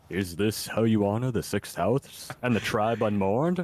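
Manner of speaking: Dagoth Ur voice. Retold or imitated. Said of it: "replacing Mister House's dialogue with Dagoth Ur".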